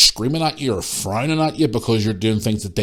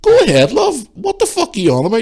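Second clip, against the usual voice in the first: high, loud, scornful comeback; indignant challenge; 11 semitones higher, 5 dB louder than usual. Retold or imitated. Shopper daring the woman to report her, indignant challenge. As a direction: dare her, pitched right up. Retelling an argument in store.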